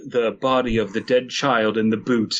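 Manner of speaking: ordinary talk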